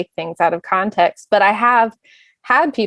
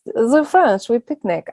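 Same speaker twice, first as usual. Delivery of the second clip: badly faked French accent, pitched up; terrible French accent; 3 semitones higher, slower than usual. Joking to the panel that picnic is French, in a terrible French accent. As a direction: put on a hammy, unconvincing French accent. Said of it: "That was my terrible French accent".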